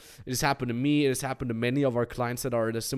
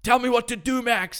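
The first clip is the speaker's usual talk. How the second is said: freaking out; 10 semitones higher, 4 dB louder than usual. Retold or imitated. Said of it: "this freak out moment".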